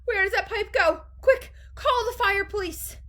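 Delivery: high, loud and frantic; yelled. A narrator's reading. Mother demands the fire police, yelled. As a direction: cry out for emergency help. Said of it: "yelled Missus Gloop".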